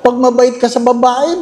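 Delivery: ordinary talk, nothing marked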